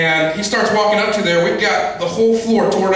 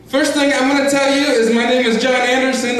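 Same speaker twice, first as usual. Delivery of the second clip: higher-pitched phone salesman; foreign telemarketer impression; 5 semitones higher than usual. Caller claiming an English name, high put-on voice. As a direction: introduce yourself as a put-on cold caller. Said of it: "this guy calling me and says".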